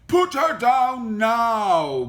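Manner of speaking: slow, loud, high bellow; bellowing woodcutter. A narrator's reading. Woodcutter ordering the wolf off Little Red, loud bellow. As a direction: bellow the command, stretching the words. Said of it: "bellowed the woodcutter".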